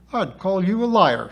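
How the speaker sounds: high, loud accusation; spitting retort. A narrator's reading. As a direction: spit it out indignantly. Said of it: "spat Sybil".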